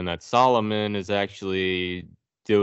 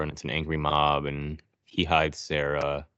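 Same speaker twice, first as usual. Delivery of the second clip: ordinary delivery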